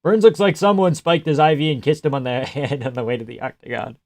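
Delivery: ordinary talk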